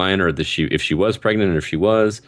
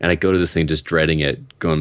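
ordinary talk, nothing marked

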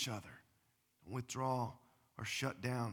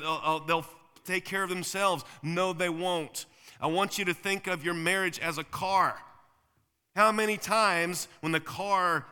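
emphatic preaching; 5 semitones higher, 6 dB louder than usual. Acted. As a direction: hammer each question home. Preaching, car analogy build.

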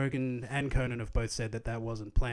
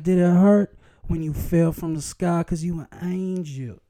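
cheesy pickup line; 5 semitones higher, 7 dB louder than usual. Pretend. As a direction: ham up a corny pickup line. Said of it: "I'll talk just like that".

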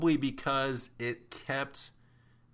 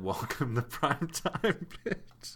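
villain one-liner; 4 semitones higher, slower than usual. Retold or imitated. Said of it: "The villain said".

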